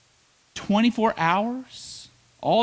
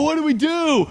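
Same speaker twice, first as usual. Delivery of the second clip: higher, louder frightened cry; mock alarm; 10 semitones higher, 3 dB louder than usual. Israelites seeing the Egyptian army coming, higher mock alarm. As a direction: play the trapped Israelites in a panic. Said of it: "They go".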